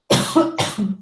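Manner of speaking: rough choking cough after a drink. Real, not acted. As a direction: cough as if something went down wrong. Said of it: "me engasguei".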